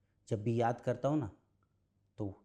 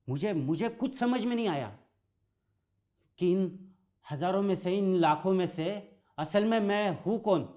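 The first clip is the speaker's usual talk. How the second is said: higher-pitched, bewildered and afraid; growing fear; 6 semitones higher than usual. Acted. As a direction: relive a lost child's rising panic. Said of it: "डरने ले ली". (डर और उलझन भरी आवाज़)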